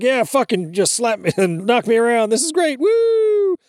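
imitating a thrilled kid, pitch climbing into a high woo. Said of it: "I loved it as a kid".

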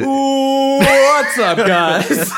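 drawn-out, loud high greeting; YouTube intro. Pretend. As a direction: stretch the greeting like a vlogger. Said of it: "I keep dragging it out".

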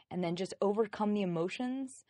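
normal talking voice

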